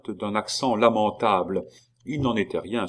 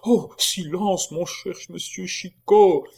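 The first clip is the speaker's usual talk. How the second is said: frightened hush; 10 semitones higher, 3 dB louder than usual. A narrator's reading. Monk hushes Chicot in fright.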